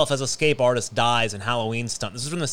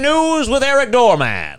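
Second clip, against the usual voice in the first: announcer voice; 11 semitones higher, 6 dB louder than usual. Pretend. Announcing breaking news segment.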